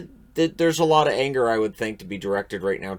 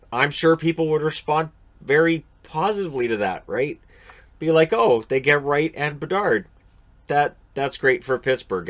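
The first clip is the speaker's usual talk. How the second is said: sarcastic; 6 semitones higher, 5 dB louder than usual. Retold or imitated. Sarcastic fan reaction.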